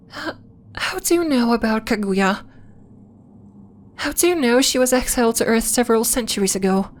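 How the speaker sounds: startled, stammering into the question; taken aback, demand to know how; shocked disbelief. Acted. Shocked visitor knows of exile.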